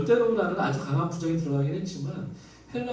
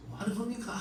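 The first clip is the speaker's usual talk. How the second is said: mock angry protest; 8 semitones higher, 7 dB quieter than usual. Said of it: "이렇게 화를 내는 거예요".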